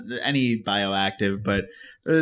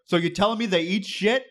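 higher-pitched incredulous question, lightly imitating a Philly guy; mildly voiced blunt Philly guy, rising at the end; Philly guy, unaccented; 8 semitones higher than usual. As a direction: quote a skeptical Philly guy without attempting the accent. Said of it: "this guy in very Phillies fashion".

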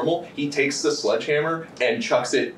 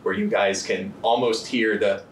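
slow, hushed suspense narration; dramatic narration; 2 semitones lower, slower than usual. Acted. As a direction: draw out each beat for tension. GM narrates tense scene.

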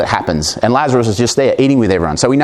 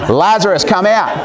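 "Lazarus, come out" called out much higher and louder; 9 semitones higher than usual. Shown as a demonstration. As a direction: call him out of the tomb at full voice. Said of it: "Let's do it again".

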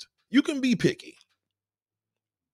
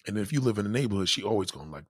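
usual speaking voice

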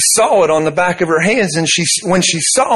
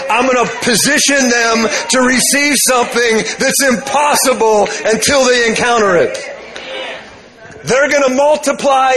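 voice of the Lord; 3 semitones higher than usual. Retold or imitated. Preacher voicing the Lord's words to him, building to a shout. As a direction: proclaim it like God himself, building to a shout. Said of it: "hey, he said".

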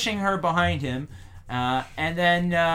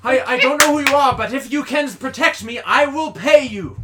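higher, louder, fast panicked plea; frightened pleading; 9 semitones higher, 10 dB louder than usual. Acted. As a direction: beg for protection in a rush. GM voices frightened noble.

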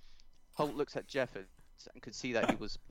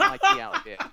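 laughing through words; 15 dB louder than usual. Acted. Villain threat, laughing.

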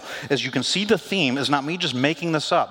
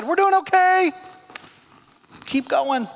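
mock breezy brush-off; 4 dB louder, slower than usual. Mock Peter brushing Jesus off.

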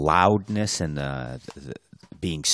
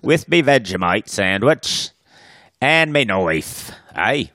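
mock Australian accent; 9 semitones higher, 7 dB louder than usual. Cohost inventing a dingo character, mock Australian accent. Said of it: "continues Aussie bit".

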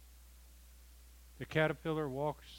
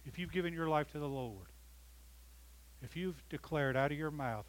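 ordinary delivery

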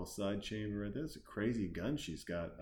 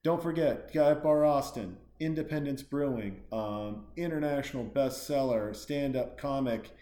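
loud, projected show outro; punchy plug-reading announcer delivery; announcer sign-off; 6 dB louder than usual. Real, not acted. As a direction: address the crowd like an emcee. Gives the show's sign-off plugs.

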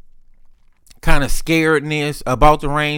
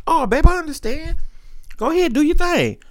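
put-on high voice; 14 semitones higher than usual. Replying as she heads to the cigar bar, put-on falsetto. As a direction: sing it out high, playfully understanding. Acting out his reply to her.

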